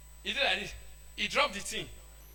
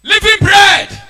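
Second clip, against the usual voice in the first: loud, higher proclamation; proclaiming shout; 8 semitones higher, 18 dB louder, much slower than usual. Real, not acted. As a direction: cry it out to the hall. Preacher proclaiming.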